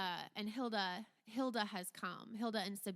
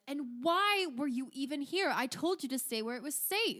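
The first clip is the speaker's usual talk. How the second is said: higher, louder scolding; raised, exasperated reprimand; rounding on her; 6 semitones higher, 8 dB louder than usual. Acted. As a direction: scold her like an alarmed guardian. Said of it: "he rounds on you".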